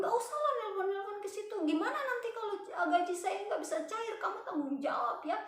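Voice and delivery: high, hurried mock complaint; agitated scammer impression; retold anger. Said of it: "Terus langsung dia marah".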